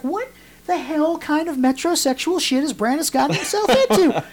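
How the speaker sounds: high-pitched comic incredulity; retold disbelief. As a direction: pitch it way up, baffled by the shirt. Retold outrage at the shirt.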